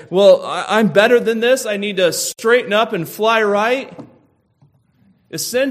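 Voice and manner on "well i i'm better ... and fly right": much higher and louder, puffed-up pep talk to oneself; brisk self-improvement talk, higher and louder; self-righteous resolve. Pretend. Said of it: "Do you say".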